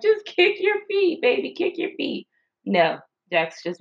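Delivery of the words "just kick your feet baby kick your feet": put-on high, sing-song coaxing to a toddler; coaxing baby. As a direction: sweetly urge the baby to kick. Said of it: "I'm sitting there like".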